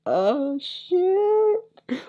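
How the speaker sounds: very high, drawn-out shriek of panic; panicked squeak. Retold or imitated. Caught by his girlfriend, high panicked squeal. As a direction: squeal it in horror. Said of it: "And so I'm like".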